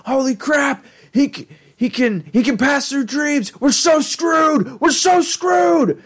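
very high, shouted mock panic; Rick. Rick fleeing Scary Terry, high hammed-up panicked shriek. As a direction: wail it, stretching the last cries.

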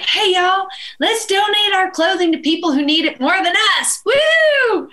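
rally the friends like a cheerleader; excited rallying cry. Retold or imitated. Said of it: "And I was like".

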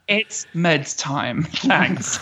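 slow deadpan quote. Retold or imitated. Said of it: "I was like".

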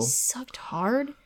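breathy whisper, slowed right down. Acted. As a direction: whisper it slowly like a secret. Reading erotic scene aloud.

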